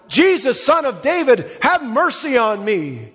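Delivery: high, insistent imitated plea. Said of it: "He cried out all the louder".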